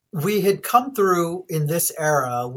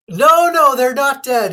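loud protest; 10 semitones higher, 10 dB louder than usual. Real, not acted. Laughing protest at cohost's joke.

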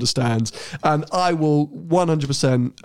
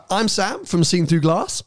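ordinary talk

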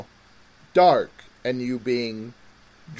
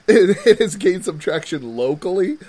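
laughing; 4 semitones higher, 5 dB louder than usual. Real, not acted. Laughing at own word choice.

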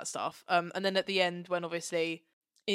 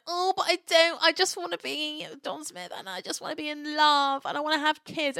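mocking whine, pitched higher; whiny John Smith; 7 semitones higher, 4 dB louder than usual. Mocking John Smith for moping during the bombing, whiny. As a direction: whinge it like a self-pitying man. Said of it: "when men whine".

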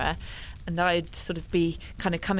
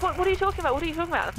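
much higher, louder panicked question; 11 semitones higher, 4 dB louder, much faster than usual. Retold or imitated. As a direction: ask in rising panic. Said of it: "I was like".

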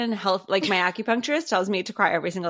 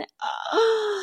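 sharp high gasp of delight; excited gasp. Real, not acted. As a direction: gasp like the taste just hit you. Tasting memory, excited about burgers.

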